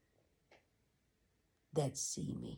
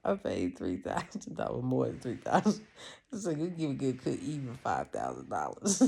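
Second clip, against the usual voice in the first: much louder, joking outrage; loud laughing rant; 8 dB louder than usual. Real, not acted. Complaining to viewers about the price, he rants loud and laughing. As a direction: belt it out, half joking.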